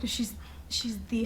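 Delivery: whispered and slow; slow awed whisper about a crush. Real, not acted. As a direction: breathe it out, starstruck.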